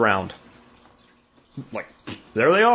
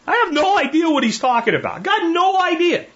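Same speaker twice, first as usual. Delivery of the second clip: much higher, bewildered. Retold or imitated. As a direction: sound completely lost and confused. Retold confusion over the accusation.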